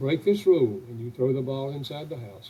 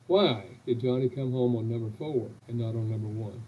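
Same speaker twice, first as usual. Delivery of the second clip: ordinary delivery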